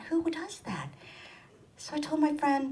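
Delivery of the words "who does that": high hushed aside; whisper. Real, not acted. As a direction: lean in and whisper to the audience. Aside to audience.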